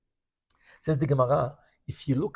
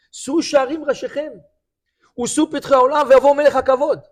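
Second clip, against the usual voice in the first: Solomon's plea sung out higher and louder; louder repeated plea; 7 semitones higher, 6 dB louder, much faster than usual. Retold or imitated. King begs the gates to open, raised and louder. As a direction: call it out to the gates, with more force. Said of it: "And then he repeated again".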